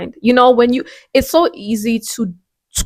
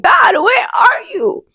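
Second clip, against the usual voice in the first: crying out to God; 12 semitones higher, slower than usual. Retold or imitated. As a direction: cry it out, desperate for an answer. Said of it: "I find myself sometimes just crying, like".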